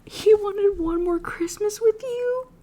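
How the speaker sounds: high, slow, tearful whisper breaking apart; real tears choking the words; crying. Real, not acted. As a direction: sob the line out as it hits you. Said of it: "That is so sad".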